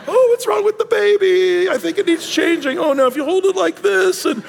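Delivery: high, fussy grandma-style fretting; fussing grandma voice. Retold or imitated. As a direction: fret over the baby like a doting grandmother. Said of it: "they're just watching".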